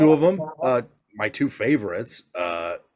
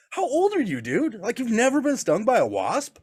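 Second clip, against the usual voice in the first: high, incredulous teasing; teasing disbelief; 10 semitones higher than usual. Retold or imitated. Dad teasing a kid scared of a wasp sting, high and incredulous. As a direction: rib him for never being stung.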